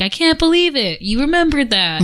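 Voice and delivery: high, amazed mock gasp of delight; delighted surprise. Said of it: "you're like".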